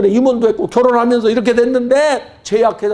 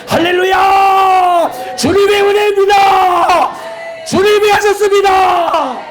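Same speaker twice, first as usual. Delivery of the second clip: high, loud hallelujah shout; fervent preaching shout; 12 semitones higher, 4 dB louder, slower than usual. Acted. Preacher rousing the congregation, fervent high shout. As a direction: preach it full force.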